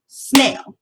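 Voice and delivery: Snail said once, loud, with a clap; one firm beat on Snail; clapped syllable. Shown as a demonstration. Teacher claps the word Snail for the class. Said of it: "I'm gonna clap the word snail".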